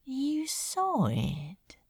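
soft and slow, barely voiced; soft slow question. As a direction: ask it gently, hushed. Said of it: "his voice was soft".

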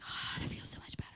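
breathy whispered relief; relieved whisper. Retold or imitated. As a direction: sigh it out in a whisper. We're like.

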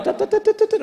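rapid high tut clicks; imitating the agent's tutting. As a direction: click the tongue like typing.

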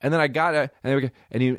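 normal talking voice

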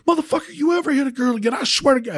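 high, fast furious threat; angry threat. Retold or imitated. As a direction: snarl a warning over him. Standing over the guy, threatening.

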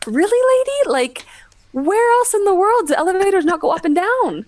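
high disbelieving exasperation; incredulous. Retold or imitated. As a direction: scoff at the guest in disbelief. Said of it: "So I thought".

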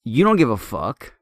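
retold angry telling-off of a customer; indignant outburst. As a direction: let the outrage spill out at him. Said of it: "I'm like".

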